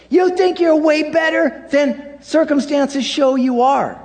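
Jesus, high and pressing, calling out Peter's pride; scolding Jesus. Acted. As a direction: rebuke him like a frustrated teacher, high. Jesus rebukes Peter, voiced.

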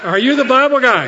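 calling out loud and high, full of hope; eager loud question. Retold or imitated. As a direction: ask it like you've waited all night for him. Villager asks excitedly, climax.

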